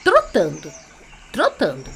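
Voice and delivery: plain reading voice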